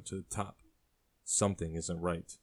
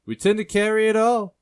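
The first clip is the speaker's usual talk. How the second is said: high, loud singsong barkeep; proud barkeep; 12 dB louder than usual. Acted. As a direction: call it out high, holding the last word. Barkeep boasts of his stock.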